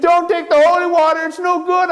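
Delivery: Shorty mocking the priest chasing her; imitating priest. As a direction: shout after the thief in a silly high voice. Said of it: "running after me saying".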